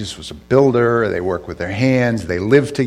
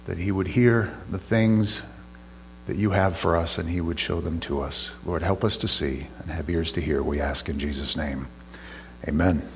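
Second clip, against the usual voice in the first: soft, low-key prayer, trailing quieter; spoken prayer; 3 semitones lower, 5 dB quieter than usual. Real, not acted. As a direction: pray softly on the listeners' behalf. Leads a prayer.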